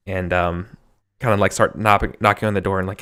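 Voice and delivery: normal talking voice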